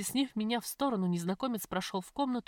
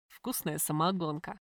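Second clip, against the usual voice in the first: mocking; 3 semitones lower than usual. A narrator's reading. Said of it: "насмешливо прозвучал незнакомый баритон".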